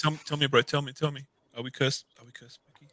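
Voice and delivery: pleading, sinking to a whisper; fading to a whisper. Acted. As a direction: drop from a murmur into a breathy whisper. Pleading over a feared curse.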